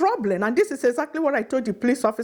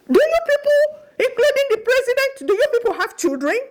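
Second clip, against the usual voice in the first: high, loud cry at the whole university; anguished outcry; 12 semitones higher, 3 dB louder than usual. Real, not acted. Mother crying out at the university staff, high and loud. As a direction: cry out the question in outrage.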